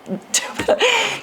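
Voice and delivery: a quick high giggle; short laugh. Real, not acted. Amused by a voter asking for her ballot, short high laugh. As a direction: laugh it off lightly.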